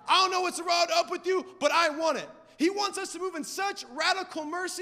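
driving, emphatic sermon delivery; punchy pulpit proclamation; impassioned preaching. Real, not acted. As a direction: preach it with rising conviction. Preaches with force.

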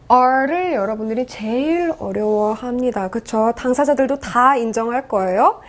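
ordinary talk, nothing marked

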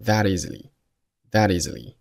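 "that easily" with the t tapped as a very light d; flap T. Shown as a demonstration. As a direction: link "that easily" through a weak d-like tap. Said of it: "可以做一个flap T".

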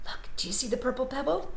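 ordinary delivery, nothing marked

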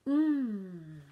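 big relishing hum; loud mmm. Acted. Child tasting the icing, loud happy hum. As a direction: savour the icing.

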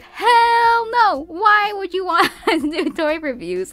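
high, loud, laughing Hell no; incredulous refusal. Retold or imitated. Vlogger retelling how she turned down a friend's toy channel, loud high mock outrage. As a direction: scoff at the idea like it's absurd.

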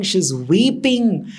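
ordinary delivery, nothing marked

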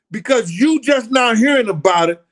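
loud, high-pitched rebuke; indignant scolding. Real, not acted. Scolding viewers who just heard about it, loud and indignant. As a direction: raise the voice and pitch at a doubter.

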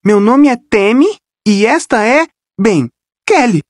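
voz leve de líder de torcida animada; apresente-se toda simpática. Acted. Tammy apresenta-se ao microfone. (Tammy apresenta Kelly, animada)

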